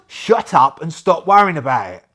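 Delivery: loud, annoyed order to shut up; angry shout. Acted. Staff shuts down Jack's questions, a loud angry shout. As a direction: bark it to end the argument.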